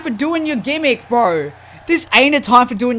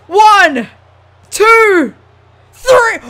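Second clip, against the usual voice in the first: loud count climbing higher on each number; excited pinfall count; 7 semitones higher, 6 dB louder, much slower than usual. Real, not acted. Gamer counting along with the pin, excited shouting. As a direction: count the pin, tension mounting.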